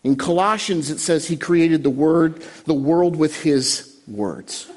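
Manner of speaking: usual speaking voice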